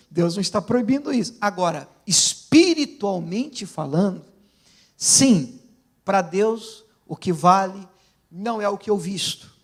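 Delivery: ordinary talk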